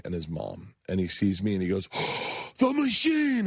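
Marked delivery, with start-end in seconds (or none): 2.2-3.5 put-on excited kid. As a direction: gasp it out like a thrilled boy. Kid spots star.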